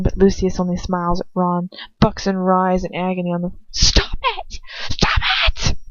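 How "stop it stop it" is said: high, breathy stifled scream; half scream. Acted. Narrator stifling a scream so the house won't hear. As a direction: scream without letting it out. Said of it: "I have the worst, like, half scream".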